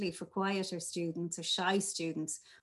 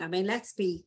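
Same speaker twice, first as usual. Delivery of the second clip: normal talking voice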